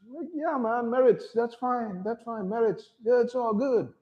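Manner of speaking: high, soft lazy drawl; mock laid-back dude. Mocking Europeans who wave off race, high and soft. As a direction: shrug it off like a stoner.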